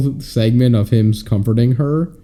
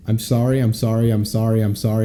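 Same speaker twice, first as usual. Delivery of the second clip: rote apology; 2 semitones lower, 5 dB quieter than usual. Acted. Co-host acting out hundreds of apologies, rote and droning. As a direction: repeat it mechanically on one note, quietly. Mock endless apology, counted on fingers.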